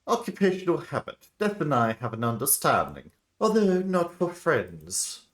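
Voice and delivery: high, loud theatrical doctor voice; composed doctor. Acted.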